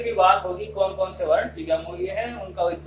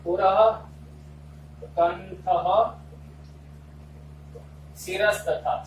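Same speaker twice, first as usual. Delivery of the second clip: shloka recitation; 4 semitones lower, 7 dB quieter than usual. Shown as a demonstration. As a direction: recite it piece by piece, lower and deliberate, as if dictating. (धीमे, ठहर-ठहर कर श्लोक पाठ)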